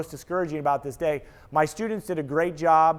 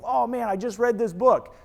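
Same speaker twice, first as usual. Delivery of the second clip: higher-pitched mock-eager doctor; mock-excited, lifted delivery; gushing mock enthusiasm; 4 semitones higher than usual. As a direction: imitate a doctor too excited to listen. Plays a doctor gushing instead of asking.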